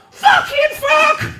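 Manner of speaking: drawn-out, very high swear; exasperated outburst. Acted. Man jolted awake by the sign-off, exasperated. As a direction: burst out in high-pitched fury.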